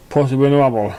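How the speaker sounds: usual speaking voice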